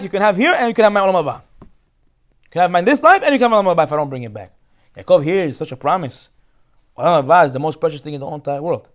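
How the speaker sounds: ordinary talk, nothing marked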